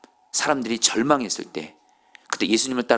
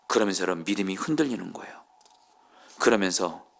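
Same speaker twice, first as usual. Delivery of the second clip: ordinary talk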